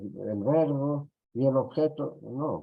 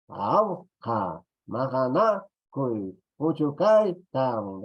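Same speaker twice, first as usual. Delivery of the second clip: slow, loud, higher, each syllable set apart; 3 semitones higher, 4 dB louder, slower than usual. Shown as a demonstration. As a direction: spell the verse out in beats, stressing "majana". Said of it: "sounding out the poem to show stress".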